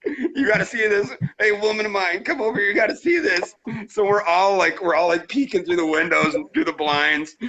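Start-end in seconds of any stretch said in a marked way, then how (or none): 0.1-3.6 higher, eager call to the family; hurried excited summons. Retold or imitated. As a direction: call the kids over, thrilled. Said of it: "I'm like".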